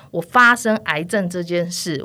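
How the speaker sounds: ordinary talk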